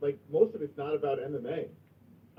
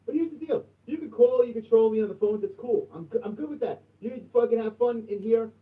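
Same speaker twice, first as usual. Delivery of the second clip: raised, higher heated rant at the trolls; angry warning; 7 semitones higher, 5 dB louder than usual. Real, not acted. Streamer warning his chat not to report the show, heated loud rant. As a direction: build it up loud and high, laying down the law.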